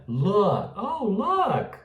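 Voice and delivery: lax "look", final K barely released; correct pronunciation. Shown as a demonstration. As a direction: say "look" short, then pitch "Oh, look" high. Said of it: "É look". ("look" curto, entre U e O)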